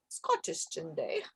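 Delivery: quiet, breathy Scottish accent attempt; a quick try at a Scottish accent. Shown as a demonstration. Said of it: "That was my Scottish".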